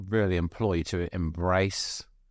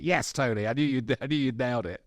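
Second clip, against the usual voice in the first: higher, quick burst of congratulation; excited, rapid-fire praise; thrilled retold cheer; 5 semitones higher than usual. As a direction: cheer on a friend's success, retold. Retold reaction to friend's praise.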